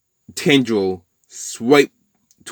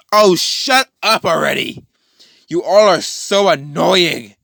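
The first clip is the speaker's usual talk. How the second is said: high, slow, drawn-out mock annoyance; hammed-up irritated villain; exasperated; 6 semitones higher, 4 dB louder, slower than usual. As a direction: whine it out, fed up with them. Villain snapping at noisy crowd.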